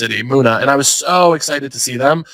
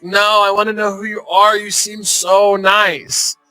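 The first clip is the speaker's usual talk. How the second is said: put-on higher, drawn-out drunken gushing; mock drunk woman, stretching the words; imitated drunk heckler; 6 semitones higher, slower than usual. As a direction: gush slowly like a tipsy heckler. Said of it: "I think drunk".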